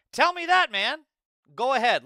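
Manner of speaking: loud angry challenge; shouting. Real, not acted. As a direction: yell it at him. Shouting at opponent in argument.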